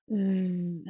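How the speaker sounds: "ذ" held as a voiced th, buzzing; long droning voiced-th "ذ"; fly-buzz ذ. Shown as a demonstration. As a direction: hum out "ذ" like a fly, tongue between teeth. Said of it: "Like the sound flies create".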